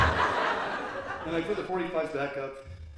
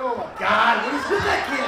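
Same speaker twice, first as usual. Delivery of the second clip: loud outburst of irritation; exasperated stepfather; 4 semitones higher, 7 dB louder than usual. Retold or imitated. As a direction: complain loudly about a maddening child. Woken stepfather complains about kid.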